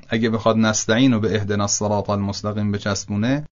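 ordinary delivery

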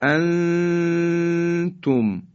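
انتم with a long nasal hum on the hidden n; drawn-out chanted انتم, n veiled into the t; ikhfa recitation. Shown as a demonstration. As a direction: hold the n of انتم high and steady before tum.

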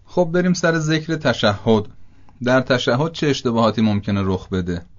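ordinary talk, nothing marked

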